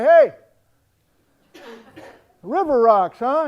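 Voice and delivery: called out loudly over the howling wind; shouting over wind. Retold or imitated. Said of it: "And I say".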